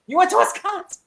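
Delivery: squeaky-high astonished question; mock incredulity. He hears she went to Wisconsin, very high mock disbelief. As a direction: send the voice way up in baffled imitation. Acting out Clark's incredulous line.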